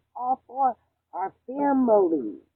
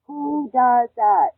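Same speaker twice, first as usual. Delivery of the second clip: outraged, drawn out; 4 semitones higher, 12 dB louder, much slower than usual. Real, not acted. Outraged rant about partner.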